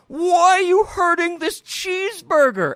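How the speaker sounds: high, loud, wailing whine; mock sobbing plea for the burger; hammed-up high weepy voice; crying fat man. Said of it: "starts to cry".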